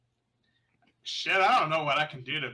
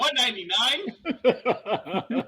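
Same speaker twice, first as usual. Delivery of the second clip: laughing; 11 semitones higher, 7 dB louder, much slower than usual. Real, not acted. Laughing at a stat.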